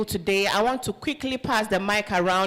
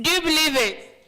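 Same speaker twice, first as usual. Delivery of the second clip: higher, shouted call; rally shout; 5 semitones higher than usual. Real, not acted. Host shouts to the room to fire them up. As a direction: fire up the crowd.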